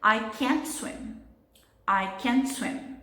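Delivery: can't strong and prominent, I and swim tucked away; slow demo sentence with the weight on can't; stress on can't. Shown as a demonstration. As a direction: lean hard on can't, shrink I and swim. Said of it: "can't ficou mais forte".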